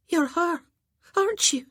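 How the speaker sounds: awed and near tears as she recognises her; trembling voice. A narrator's reading. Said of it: "her lip trembled".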